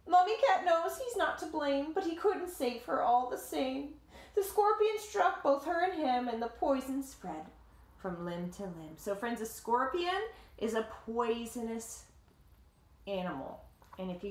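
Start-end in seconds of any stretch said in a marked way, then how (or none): 0.1-9.0 lively read-aloud voice, higher than the chat; story narration. A narrator's reading. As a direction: tell it like a picture book to kids. Reading the picture book aloud.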